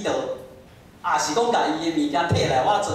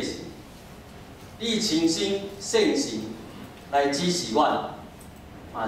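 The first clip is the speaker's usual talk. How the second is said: prayer; 6 semitones lower, 4 dB quieter than usual. Acted. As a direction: pray softly and reverently. Leads closing prayer.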